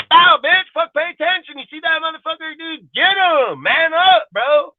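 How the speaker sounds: very high, hammed-up heckling; heckling fan. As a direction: scream down at the field. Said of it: "been like".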